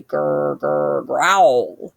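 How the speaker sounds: low rumbling growl. A narrator's reading. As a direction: growl like a hidden beast. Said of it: "scariest sound of all".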